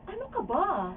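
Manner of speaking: high-pitched, mild acted reproach; high little "oh, come on" scolding; gentle chiding. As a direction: chide them lightly, pitched well up.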